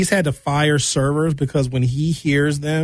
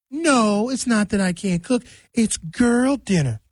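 higher, drawn-out defensive parody voice; mock defensive girl; 4 semitones higher, slower than usual. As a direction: play the girl insisting it's a trend.

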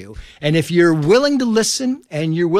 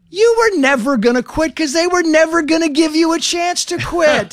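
mom's voice; 12 semitones higher than usual. Retold or imitated. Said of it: "My mom's like".

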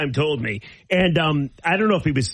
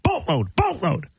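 Pat Caputo; 3 semitones higher than usual. Retold or imitated. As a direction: lightly imitate a colleague's mocking repeat. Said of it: "Pat's like".

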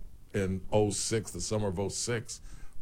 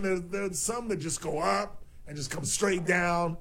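usual speaking voice

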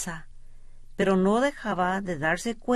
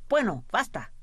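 impatient interruption; 3 semitones higher than usual. A narrator's reading. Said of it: "interrumpió el jefe".

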